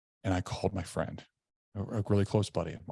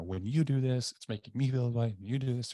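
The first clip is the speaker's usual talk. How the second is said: mildly imitated accusation, pitched higher; accusatory; 5 semitones higher than usual. Husband confronts wife about his feelings, mildly accusatory. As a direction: lightly re-enact the raised-pitch complaint. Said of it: "the way I delivered it".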